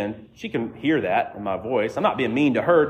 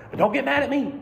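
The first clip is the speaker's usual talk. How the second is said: mock defensive, much higher; defensive officer; 12 semitones higher than usual. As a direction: imitate a cop getting defensive. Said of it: "Immediately defensive".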